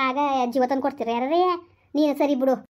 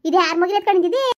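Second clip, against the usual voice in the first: higher and louder startled ask; surprised question; 4 semitones higher, 6 dB louder than usual. Acted. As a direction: gasp out who the baby belongs to. Neighbour sees the baby. (ಆಶ್ಚರ್ಯದಿಂದ ಜೋರಾಗಿ ಕೇಳುವುದು)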